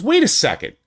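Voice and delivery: imitating the writer's sudden delight at spotting a fan; high excited mock recognition; excited retold quote. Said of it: "and he goes".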